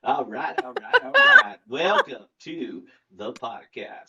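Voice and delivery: hammed-up McConaughey catchphrase; Matthew McConaughey impression. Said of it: "my shitty Matthew McConaughey impression".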